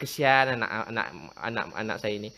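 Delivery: put-on soft, low, coddling murmur over a child; doting parent. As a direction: croon it like an indulgent father. (ibu bapa memanjakan anak dengan suara lembut dan rendah)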